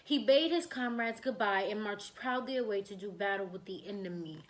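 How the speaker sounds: ordinary delivery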